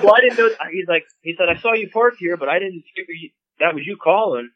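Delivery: high, whiny mock of the shooter; hammed-up flustered hunter excuse; sheepish hunter. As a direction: make the guy sound flustered and lame. Imitating the hunter who shot at him.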